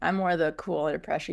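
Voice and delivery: ordinary delivery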